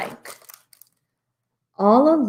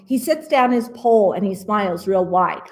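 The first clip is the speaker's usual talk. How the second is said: ordinary delivery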